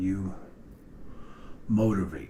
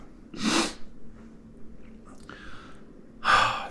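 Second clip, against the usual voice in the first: film winding noise. Shown as a demonstration. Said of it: "That's the noise I'm making".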